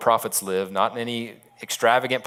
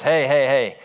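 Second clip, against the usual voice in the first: louder mock hail on arrival; acted hearty call; 3 semitones higher, 5 dB louder, slower than usual. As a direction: announce yourself breezily to the whole palace. Commander rolls into the palace.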